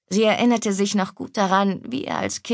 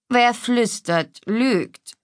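slow, stern pronouncement; loud and stern; 4 semitones higher, much slower than usual. A narrator's reading. Mother reproving her whispering daughters, mildly loud and stern. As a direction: lay down the rule slowly, mildly stern. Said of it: "sagte Stefanie laut und streng".

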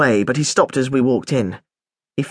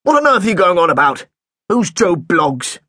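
a hint of a cross headmaster; exasperated headmaster; 4 semitones higher, 3 dB louder than usual. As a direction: headmaster losing patience with a pupil, mildly irritated.

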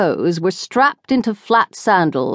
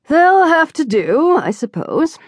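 condescending matron; 10 semitones higher than usual. A narrator's reading. Barbara dismissive about crackers.

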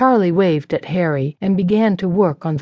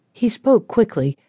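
usual speaking voice

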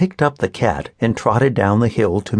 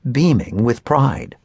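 ordinary delivery

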